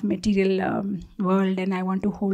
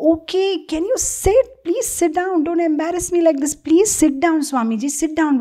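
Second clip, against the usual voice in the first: higher, pleading, a little panicked; flustered police officer; 6 semitones higher than usual. Retold or imitated. Shocked officer begs the bowing swami, flustered and high. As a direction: beg the swami to stand up, embarrassed. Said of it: "And the police is".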